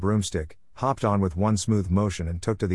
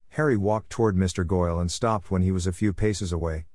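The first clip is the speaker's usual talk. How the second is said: ordinary delivery, nothing marked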